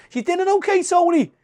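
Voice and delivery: high sing-song hostess voice; mimicking Christine. Retelling Christmas dinner, mimicking sister-in-law's high chirpy hostess voice.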